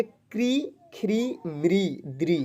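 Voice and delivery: क्री, ख्री, म्री, द्री, each with a long drawn "ree"; syllable reading demo. Shown as a demonstration. Teacher reading ri-matra syllables for pupils, slowly drawn out. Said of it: "इसको हम लोग पढ़ेंगे".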